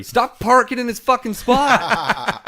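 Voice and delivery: loud, slow, laughing scold; mock angry yell. Scolding the guy who keeps taking his spot, loud and laughing. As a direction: bark it like a fed-up neighbour.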